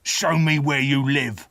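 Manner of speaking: rough convict's order; menacing demand. A narrator's reading. Convict questions the boy in the churchyard, demanding. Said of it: "the terrible man demanded".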